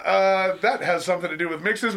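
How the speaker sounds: ordinary delivery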